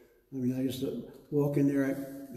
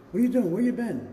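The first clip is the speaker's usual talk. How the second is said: put-on higher, louder demanding old dad; dad on the phone; 8 semitones higher, 7 dB louder than usual. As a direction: call out like an impatient father. Dad calling, voiced by son.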